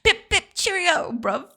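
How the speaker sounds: high-pitched, hammed-up British accent; mock British. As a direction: sign off as a cartoon Brit. Comic British sign-off.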